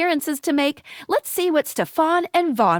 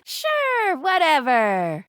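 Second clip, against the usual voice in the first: dismissive; much slower than usual. Acted. Host brushes the player off.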